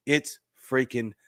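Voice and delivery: usual speaking voice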